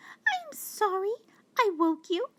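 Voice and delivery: tremble out an apology as a tiny mouse; trembling mouse. Acted. Mouse apologising to the woken lion, high and trembling. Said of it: "she said, trembling".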